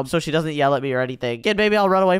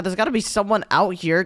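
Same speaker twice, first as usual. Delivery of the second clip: ordinary talk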